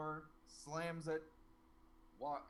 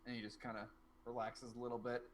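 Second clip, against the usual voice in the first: ordinary talk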